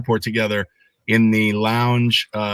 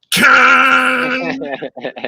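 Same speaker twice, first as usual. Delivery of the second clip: a big, loud, high roaring whoosh, like THX; THX sound blast imitation; 12 semitones higher, 7 dB louder than usual. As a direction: blare out the booming cinema intro sound. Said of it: "You know, it's like".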